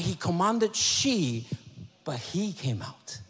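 slow, "she" stretched out, then "he" stressed; stress on pronouns. Shown as a demonstration. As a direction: contrast "she" against "he" with long emphasis. Contrasts the two pronouns.

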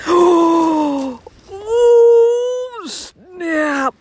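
gasp, then a high yelling burst and laugh; real thrill at finding it, loud and high; excited shout. Real, not acted. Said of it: "I'm sorry if I blasted your ear out".

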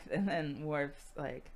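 ordinary delivery

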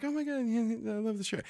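high, light imitation of delight; gushing wife. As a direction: coo over it like a flattered wife. Voices gushing wife again.

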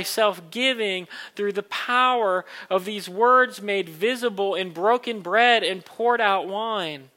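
fervent pastor's prayer. Acted. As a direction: pray it with rising fervour. Pastor leads communion prayer.

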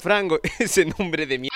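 normal talking voice

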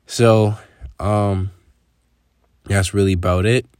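normal talking voice

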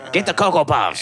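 high, squeezed cartoonish voice; cereal-fetching bit. Friend acting out the shoulder-climb for cereal, high squeezed voice. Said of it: "get cereal in the supermarket".

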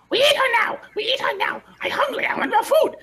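very high, yelling hungry goblin; high goblin screech demanding to eat. Acted. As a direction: squeal it like a greedy little goblin. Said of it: "One, one of them's like".

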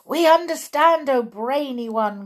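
high, loud, quick opening; eager crowd cry. Acted. Witches' audience answering their leader, high excited cry. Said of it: "cried the audience".

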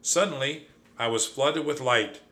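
usual speaking voice

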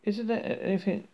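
slightly squawky plea; squawking. A narrator's reading. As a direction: squawk the plea lightly, without volume. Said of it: "squawked Ford".

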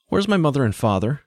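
put-on high-pitched child's question; young boy wanting an answer. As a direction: ask as a boy looking for his parents. Boy demands his missing parents.

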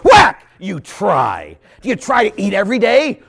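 loud, high challenging jab; mock scolding. Priest confronting a man who only tries, mock scolding. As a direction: challenge the excuse sharply.

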